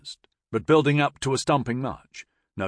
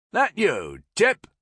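raised, rising hail; calling out; 9 semitones higher, 5 dB louder, slower than usual. A narrator's reading. Major to an old comrade on the ferry, calling out. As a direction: call out to see who is there.

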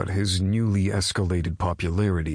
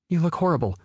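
critical mother; 11 semitones higher, 3 dB louder, much faster than usual. Acted.

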